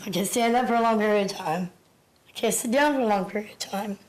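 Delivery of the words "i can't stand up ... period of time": ordinary talk, nothing marked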